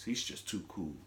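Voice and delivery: smooth cool-guy voice; low cool voice. Retold or imitated. The deep smooth voice of a cool-guy character. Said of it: "He's always talking like this".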